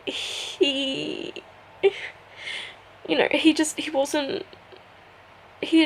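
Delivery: real sobbing while telling it; crying. Real, not acted. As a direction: voice breaking into tears. Grieving the death of her cat.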